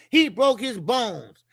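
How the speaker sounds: normal talking voice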